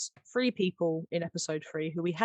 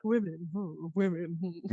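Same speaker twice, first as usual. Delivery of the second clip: mock Varn musing; 3 semitones lower, 4 dB quieter than usual. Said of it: "Varn there just being like".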